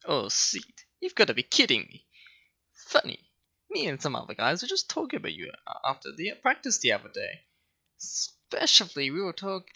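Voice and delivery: high, smug and snobby. Acted. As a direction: sneer every line like a snob. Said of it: "give him, like, a snobby voice".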